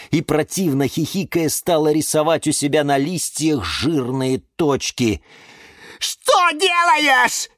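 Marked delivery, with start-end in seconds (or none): 6.0-7.5 very high, drawn-out cry; horrified shout. Acted. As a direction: squeal in outrage at her. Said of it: "закричал Лимон Филимон".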